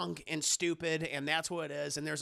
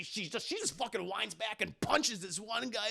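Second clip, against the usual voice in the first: ordinary talk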